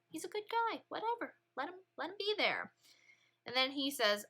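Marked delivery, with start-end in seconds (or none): 0.1-2.7 much higher, offhand and dismissive; casual shrug. Retold or imitated. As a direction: shrug it off lightly. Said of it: "He's like".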